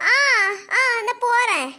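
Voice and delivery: ordinary talk, nothing marked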